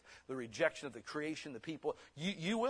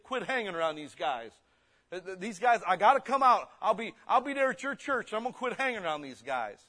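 mock promise; 4 semitones higher, 7 dB louder, faster than usual. Preacher voices a promising inmate.

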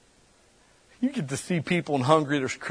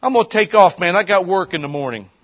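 louder, higher casual excuse voice; departing helper; 6 semitones higher, 8 dB louder than usual. Retold or imitated. Guy leaving the cleanup crew, imitated louder and higher. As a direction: toss off the excuse loudly. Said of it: "he goes".

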